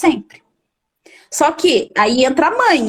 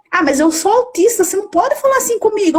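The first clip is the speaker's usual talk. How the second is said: mimicking a defiant student; 6 semitones higher, faster than usual. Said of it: "um aluno meu falou".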